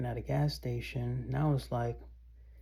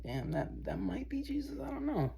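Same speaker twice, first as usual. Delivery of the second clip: high, hushed onlooker, a touch amazed; a stranger half-whispering a guess, pitched way up; awed onlooker; 12 semitones higher, 6 dB quieter than usual. Retold or imitated. Imagining a passerby wondering about him, high and hushed. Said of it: "think, like".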